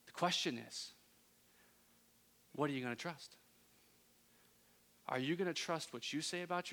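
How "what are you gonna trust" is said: ordinary delivery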